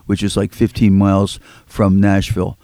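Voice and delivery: ordinary delivery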